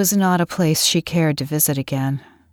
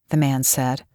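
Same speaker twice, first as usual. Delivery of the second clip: usual speaking voice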